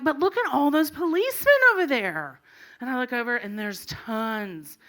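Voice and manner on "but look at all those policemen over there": high, loud mock alarm; exaggerated worried cry, pitched up; alarmed teen. Girl warns mom about all the policemen, mock alarm.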